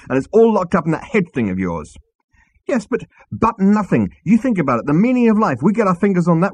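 high, loud, insistent tirade; heated hustler. Acted. Zaphod selling Arthur on the ransom money, heated. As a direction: badger him excitedly.

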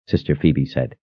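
usual speaking voice

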